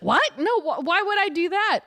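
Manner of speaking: loud, high, incredulous retort; mock outraged refusal. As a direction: protest loudly, acting out disbelief. Acting Wade refusing the plea.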